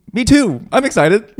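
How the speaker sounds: high-pitched parody of fake excitement; mock insincere enthusiasm. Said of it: "That was the most insincere, like".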